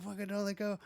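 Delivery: high-pitched mock pleading, not shouted; desperate plea. Man being dragged down by the rope, desperate plea. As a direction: imitate frantic begging to be held.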